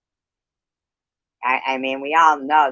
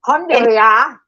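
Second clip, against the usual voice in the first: loud, high mocking repeat of Alhamdulillah; shrill loud sarcastic echo; 8 semitones higher, 10 dB louder than usual. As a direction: throw the word Alhamdulillah back at her in a sneering sing-song. Mocking the other woman's word.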